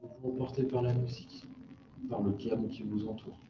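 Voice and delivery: soft, calm relaxation voice; guided meditation. Acted. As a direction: ease them into stillness.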